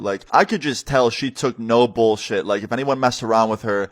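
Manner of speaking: usual speaking voice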